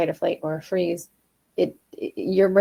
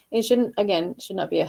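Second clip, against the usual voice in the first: ordinary talk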